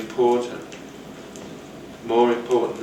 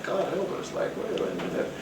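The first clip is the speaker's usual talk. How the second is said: high, soft, almost whispered dismay; breathy aside; 7 semitones higher, 9 dB quieter than usual. Retold or imitated. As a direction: mutter it under your breath in mock alarm. Mocking a booming speaker.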